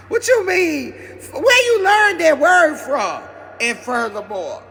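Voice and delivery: stunned owner questioning his dog; incredulous. Retold or imitated. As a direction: demand to know where he learned it.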